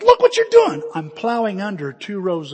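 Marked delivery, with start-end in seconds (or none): 0.0-0.8 high, loud scolding yell; yelling dad. Retold or imitated. Dad getting his son's attention, scolding yell. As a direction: yell it across a field. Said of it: "He'd been yelling".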